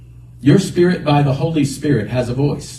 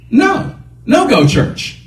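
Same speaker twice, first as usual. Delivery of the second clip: arguing with himself; 8 semitones higher than usual. Retold or imitated. Driver resisting the voice, arguing with himself. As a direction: insist on the bar over church. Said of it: "I had an argument with myself".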